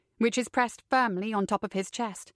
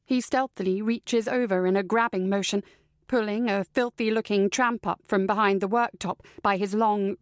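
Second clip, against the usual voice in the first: ordinary delivery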